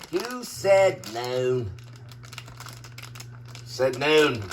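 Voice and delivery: low, slow, deadpan. Pretend. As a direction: drop it low and drag it out. Repeats line in two voices.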